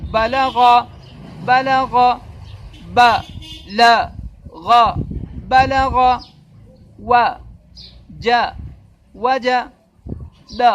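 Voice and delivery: put-on teacher sounding out letters for a child; spelling drill. Shown as a demonstration. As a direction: spell out ب ل غ, then blend to بلغ. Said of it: "باریک سا فرق ہوتا ہے".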